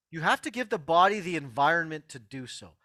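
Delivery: ordinary delivery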